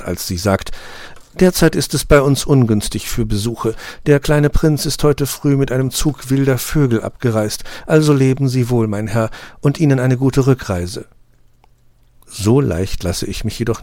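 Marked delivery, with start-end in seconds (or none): 1.4-11.1 higher, polite but cool rose voice; a courteous brush-off; slightly dismissive. A narrator's reading. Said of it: "nicht direkt unfreundlich, aber doch ein wenig abweisend". (Rose weist den Besucher höflich, aber kühl ab)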